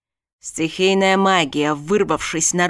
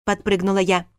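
plain reading voice

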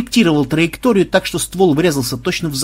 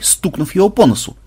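ordinary delivery

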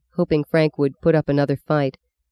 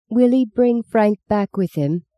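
put-on little girl voice; 6 semitones higher than usual. Meggie asks mother.

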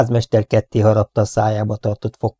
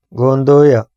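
loud mocking question; 3 semitones higher, 8 dB louder, slower than usual. A narrator's reading. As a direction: throw it back with scorn. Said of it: "kérdezte a házmester gúnyosan". (gúnyosan, hangosan)

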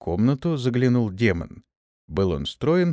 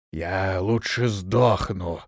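slow, low, faint wheeze; hoarse barely audible rasp; 4 semitones lower, much slower than usual. Acted. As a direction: croak it out, barely heard. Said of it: "еле слышно прохрипел".

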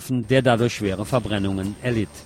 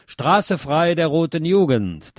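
higher, slow slogan call; rallying cry; 6 semitones higher, slower than usual. A narrator's reading. Newsreader quoting the speaker's closing cry, slow raised call. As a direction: draw out the slogan, pitched up. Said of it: "mit dem Ruf".

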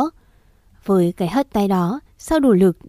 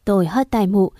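usual speaking voice

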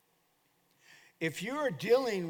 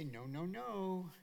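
put-on soft, drawn-out scolding of a small child; slow, gentle mock admonishing; imitating wife; 8 dB quieter, slower than usual. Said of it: "Just like that".

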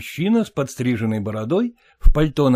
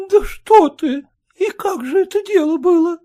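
high-pitched, louder shocked wife's voice; 5 dB louder than usual. A narrator's reading. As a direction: gasp it as an alarmed wife. Wife gasps in shock. (жена в испуге ахает, высоким голосом)

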